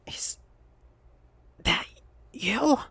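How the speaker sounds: a frail, failing whisper; feeble whisper. Acted. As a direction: breathe his name out like she's barely alive. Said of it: "in a voice like a person who was living poorly".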